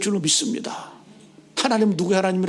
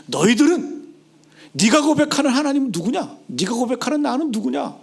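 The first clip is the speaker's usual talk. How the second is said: high, raised, insistent voice; forceful questioning; 5 semitones higher, 3 dB louder than usual. Acted. Jesus demands a confession from his disciples, forceful and high. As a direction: press the question on them.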